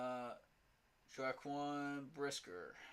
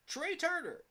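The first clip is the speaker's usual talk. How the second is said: much higher, louder Trey Turner; incredulous; 5 dB louder than usual. Real, not acted. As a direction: spit the name in disbelief. Incredulous repeat of name.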